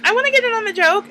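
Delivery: put-on high, sing-song mean-girl voice; mocking bully girl. Mocking a high school bully, high sing-song voice. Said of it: "the other one's like".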